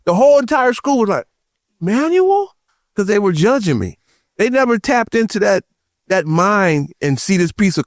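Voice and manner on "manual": very high, drawn-out mock question; disbelieving schoolmates. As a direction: imitate a whole school's incredulity. Whole school reacting in disbelief.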